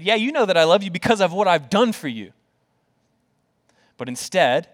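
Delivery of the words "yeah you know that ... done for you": quick, higher-pitched brag; boastful. Acted. Imagined Peter claiming credit before Jesus, boastful. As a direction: toss it off fast as a proud claim. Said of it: "Peter could have boasted to Jesus".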